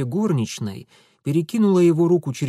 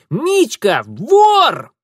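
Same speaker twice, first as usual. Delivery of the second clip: slower, louder gloating yell; taunting shout; 11 semitones higher, 9 dB louder, much slower than usual. Acted. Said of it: "торжествующее личико кузины".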